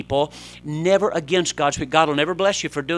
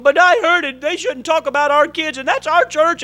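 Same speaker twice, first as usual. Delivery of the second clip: upset wife; 13 semitones higher, 7 dB louder than usual. Retold or imitated. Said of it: "She's going".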